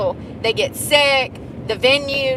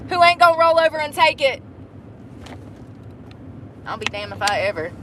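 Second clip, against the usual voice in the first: usual speaking voice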